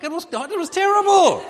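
belted out high and loud; loud exclamation. Real, not acted. Preacher to congregation, loud emphatic cry. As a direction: throw the word terrible out to the room.

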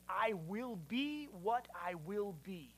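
unhurried, quiet unfolding statement; slow, open-ended declaration. Shown as a demonstration. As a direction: let each word stretch and flow gently. Contrasts the wrong and right answer.

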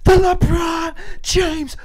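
arena intro climbing very high; PA announcer voice. Pretend. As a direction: start steady, then soar on the team name. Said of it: "we do P A announcer voices".

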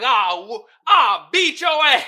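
high, loud strangled mock rage; belligerent yell. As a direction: yell like a rapper who lost it. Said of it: "he was like".

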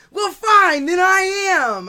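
loud, high huffy comeback; imitating annoyed officer. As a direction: act out an officer losing patience. Officer's angry comeback, retold.

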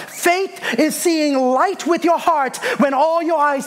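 high, driving sermon delivery; impassioned preaching. Real, not acted. Preacher urging congregation to have faith in darkness, high impassioned preaching.

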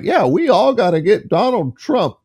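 mocking candidate. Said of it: "The rest of 'em are just like".

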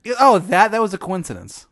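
a villain caught red-handed, brushing it off high and loud; evasive Sugar King. Acted. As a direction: squeak out a flustered excuse. Sugar King dodging blame, voiced.

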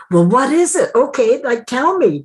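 high, pushy, impatient voice; impatient demand. Retold or imitated. Mimicking her own impatient self, demanding an answer.

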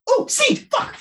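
surprised bird. Pretend.

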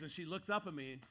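ordinary delivery

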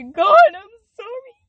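high, loud anguished plea to God, then a slow drop; a repentant daughter begging forgiveness; pleading apology. Retold or imitated. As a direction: cry out sorry to God, then trail off slowly. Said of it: "when I turn back to him and say".

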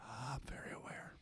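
dry whispered aside. Real, not acted. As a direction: whisper it knowingly. Dry reply.

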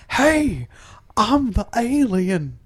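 soft, slow alien voice; breathy mock alien. Doing the Stargate cast, a soft slow alien. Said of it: "The cast of Stargate Universe".

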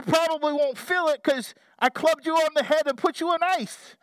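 hammed-up fisherman bellowing to fish; loud call to fish. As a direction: shout down into the water at the fish. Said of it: "call out in a loud voice".

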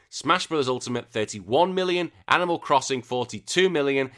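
ordinary talk, nothing marked

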